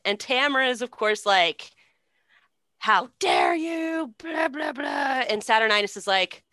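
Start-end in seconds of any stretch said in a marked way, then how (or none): 2.8-5.2 high, slow, mock indignant shout; hammed-up outrage. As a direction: ham up the offended queen. Tamara retold with outrage.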